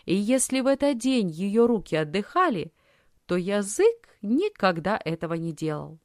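usual speaking voice

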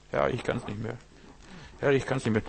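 soft, worn-out plea; weary plea. Retold or imitated. Preacher voices an exhausted believer, softly. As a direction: let it sink, exhausted and quiet.

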